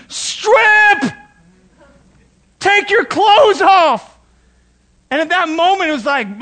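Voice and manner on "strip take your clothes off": high, loud urgent yelling; calling out from the shore; retold yell. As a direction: shout the warning, stretched out slow. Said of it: "they're yelling".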